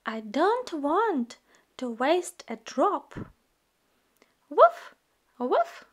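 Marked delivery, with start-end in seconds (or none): 4.5-5.9 high, loud puppy woof; puppy bark. Acted. Voicing the puppy Biscuit barking.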